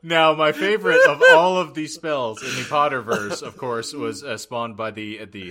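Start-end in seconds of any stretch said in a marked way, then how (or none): none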